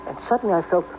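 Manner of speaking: low, measured voice-over telling the tale; low inner narration. A narrator's reading. [name] narrating his own story. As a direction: draw the listener into his memory.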